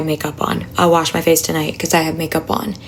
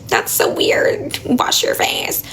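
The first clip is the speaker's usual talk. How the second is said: slow, whispered mock disbelief from critics; 5 semitones higher, slower than usual. Imitating viewers who find her skincare weird, slow whisper. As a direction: drawl it like a scoffing commenter. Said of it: "a lot of people are like".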